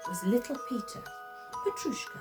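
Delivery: plain reading voice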